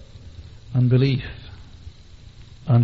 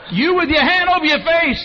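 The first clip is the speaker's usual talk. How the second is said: high, loud public call-out; single someone out at full holler; yelling across room; 13 semitones higher, 8 dB louder than usual. Retold or imitated. Friend singles him out across the cafeteria, yelling. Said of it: "yelling across the people".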